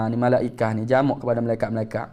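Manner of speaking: ordinary talk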